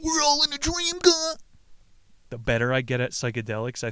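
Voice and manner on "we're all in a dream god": very high, loud mocking whine; mocking cliché. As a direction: parody a spacey hippie. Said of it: "that's not me saying".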